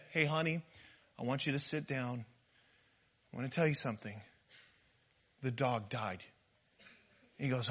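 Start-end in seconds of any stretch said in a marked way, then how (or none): 0.1-6.2 hushed, low, gentle breaking of bad news; softly, with careful pauses between phrases; easing into it. Wife showing husband how to break news, gently. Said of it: "ease into the story or something".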